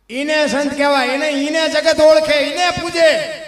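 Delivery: loud, high proclamation, last words drawn out slow; preaching at full force. Real, not acted. Preacher declaring who is called a saint, loud and soaring. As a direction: proclaim it to the whole hall.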